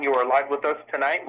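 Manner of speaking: ordinary talk, nothing marked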